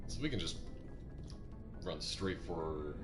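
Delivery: normal talking voice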